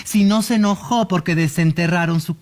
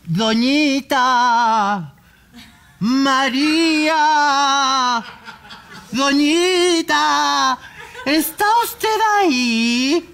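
slow, drawn-out medium call; séance summoning; 5 semitones higher, much slower than usual. Acted. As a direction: call the spirit slowly and solemnly.

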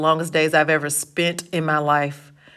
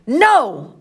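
high, vehement cry; screamed; 8 semitones higher, 4 dB louder than usual. A narrator's reading. Pony refuses to be sent home, screaming at Johnny. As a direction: burst out in a raised, high voice. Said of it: "I screamed at him".

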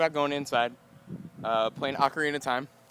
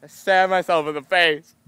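louder hammed-up yelp; loud higher mock wail; mock anguish; 5 semitones higher, 7 dB louder than usual. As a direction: play the self-stab. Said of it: "I'm like".

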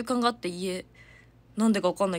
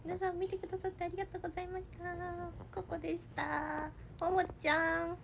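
high, soft, quick little pet voice; higher voice for the dog's goodbye; voicing the pet; 8 semitones higher, 11 dB quieter than usual. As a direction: speak for the dog as a cute tiny creature. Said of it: "何か言い残したことありますか？".

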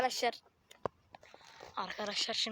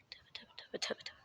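whispering; 13 dB quieter, faster than usual. Real, not acted. Whispered aside while filming.

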